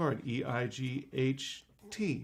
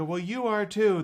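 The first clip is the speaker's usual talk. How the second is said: higher, louder mock-offended retort; childish comeback; 7 semitones higher, 6 dB louder than usual. Magician bickering back at kids, mock offended. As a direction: squabble back like a kid.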